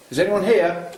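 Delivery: loud, high call into the dark; talking to a ghost. Real, not acted. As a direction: call out to the spirit. Calling out to spirits.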